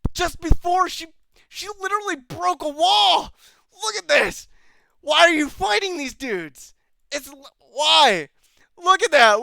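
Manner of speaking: high, loud, incredulous ranting; baffled outburst. Real, not acted. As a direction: cry out in disbelief at the screen. Baffled outburst at absurd fight scene.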